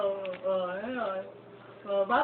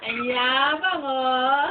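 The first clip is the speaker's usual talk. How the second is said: loud teasing; 7 dB louder, much slower than usual. Real, not acted. Teasing the proud baby.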